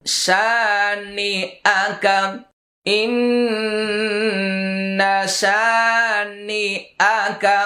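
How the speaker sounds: শানি with the vowel held one beat; slow, stretch শানি a single count; শানি আকা, one alif of lengthening. Shown as a demonstration. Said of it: "এটা এক আলিফ টান".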